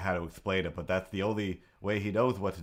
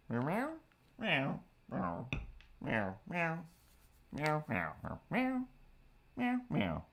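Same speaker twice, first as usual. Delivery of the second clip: high imitated cat meows; playful mock meowing, pitched way up; 5 semitones higher, 4 dB quieter, slower than usual. Real, not acted. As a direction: meow back at the cat.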